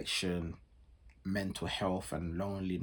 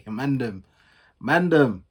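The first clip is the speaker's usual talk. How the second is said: calling out; 4 semitones higher, 11 dB louder, slower than usual. Real, not acted. Calling out to the boys.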